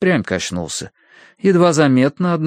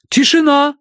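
high, loud proclamation; громкое провозглашение; 6 dB louder than usual. A narrator's reading. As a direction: call the room to order as a medium. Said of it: "провозгласила мадам Аритоцкая". (громко и высоко провозглашая)

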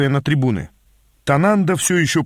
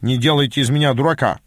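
ordinary delivery, nothing marked

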